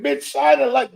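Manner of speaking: sharp incredulous outburst; exasperated outburst. Real, not acted. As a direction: snap back in disbelief. Furious rant at the speaker.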